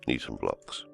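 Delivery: normal talking voice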